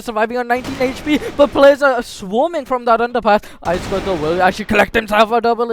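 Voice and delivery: building to a loud, high shout; excited play-by-play. Real, not acted. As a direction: call the fight rising to a yell. Hyped live play-by-play calling.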